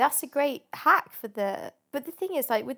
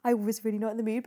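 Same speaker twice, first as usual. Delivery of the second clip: ordinary talk, nothing marked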